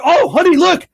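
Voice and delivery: high, excited call to honey; mock discovery. Finding a gift card in the junk drawer, calling out to honey. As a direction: call to the spouse in mock surprise. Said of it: "And then you're".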